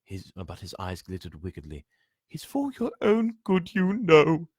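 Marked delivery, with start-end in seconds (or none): 2.3-4.5 high, sly poltergeist voice; high put-on voice of a fake helper; mock-innocent poltergeist. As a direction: pretend to care while scheming. Peeves voiced in story.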